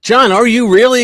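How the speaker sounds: loud, high astonished question; can't believe it, ask loud; amazed question. Retold or imitated. Narrator amazed at reunion.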